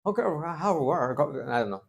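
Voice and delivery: drawn-out English with a Veracruz accent; jarocho-accented English. Shown as a demonstration. Preacher demonstrating jarocho English to his congregation, playful jarocho accent. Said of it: "un jarocho hablando inglés con acento jarocho".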